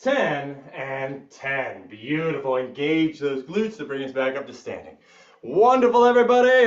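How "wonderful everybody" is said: loud, high burst of praise for the class; rally the room with big praise; cheering praise. Real, not acted. Fitness coach cheering the class on, loud and high.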